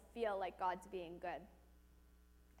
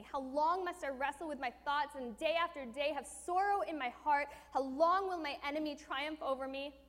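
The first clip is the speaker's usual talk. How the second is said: impassioned reading; 3 semitones higher than usual. Acted. Preacher declaiming the lament to the congregation with passion.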